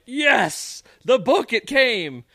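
high, slow gleeful cry; thrilled the parcel finally arrived; excited. Retold or imitated. To his wife and the podcast, a gleeful high cry of joy. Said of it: "and I'm like".

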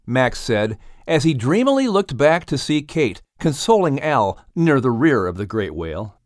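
ordinary delivery

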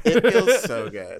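hammed-up mock moan. Friend hamming it up for laughs, a drawn-out mock moan. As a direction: stretch it out like an overdone moan.

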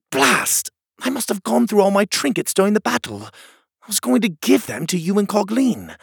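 put-on high-pitched, exasperated young hero; Pockets, dismayed. Pockets tells friend his trinkets are gone, dismayed. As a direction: exclaim with dismay, pitched well up. Character's exclaimed dismay in the story.

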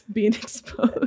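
slow words breaking up with laughter; laughing. Real, not acted. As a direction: giggle through it as if caught out. Friends laugh at being exposed.

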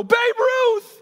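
high, loud, incredulous shout; shocked outcry. Retold or imitated. All the boys react to Smalls, a shocked high yell. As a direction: blurt it out at the top of your voice.

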